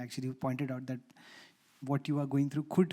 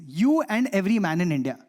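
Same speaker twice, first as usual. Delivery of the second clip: retold pronouncement, louder; emphatic verdict; 3 semitones higher, 7 dB louder than usual. Therapist confronting her male patient, emphatic verdict. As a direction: deliver it as a loud, sweeping verdict.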